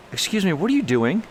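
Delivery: high-pitched, indignant retold challenge; not super polite. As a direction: confront him with an incredulous question. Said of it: "I wasn't super polite".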